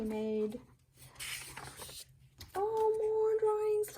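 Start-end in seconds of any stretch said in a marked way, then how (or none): 2.5-4.0 much higher, slow and drawn out; high drawn-out coo of delight. Real, not acted. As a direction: linger on it, charmed. Delighted by more drawings.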